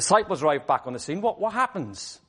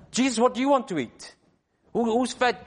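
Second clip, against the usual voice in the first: fussy disciples; 7 semitones higher than usual. Acted. Disciples fussing over food.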